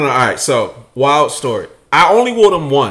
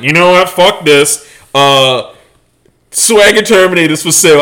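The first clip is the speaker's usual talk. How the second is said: fed-up declaration; 3 semitones higher, 8 dB louder than usual. Retold or imitated. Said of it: "I'm like".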